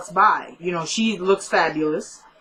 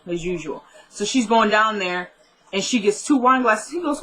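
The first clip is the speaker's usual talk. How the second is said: normal talking voice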